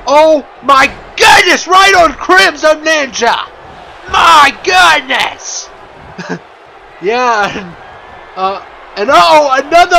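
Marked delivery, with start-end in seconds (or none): 0.1-5.7 loud, high wrestling announcer yell; excited shout. Real, not acted. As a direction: scream the big hit to the crowd. Big move lands, shouting.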